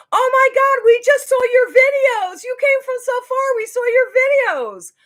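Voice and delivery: imitated loud, very high-pitched gushing; excited gushing. Star recognizes fans from their videos, excited gushing. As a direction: shout it out like an amazed star greeting a fan. Star's excited greeting acted out.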